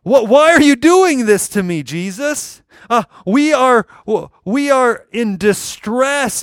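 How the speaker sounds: high, loud anxious plea; a frantic mother scolding her son; distressed mother. Pretend. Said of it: "she's saying".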